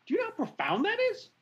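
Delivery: quick, high, breathless wonder; amazed. Real, not acted. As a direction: rush it out in awe. Marveling at God's choice.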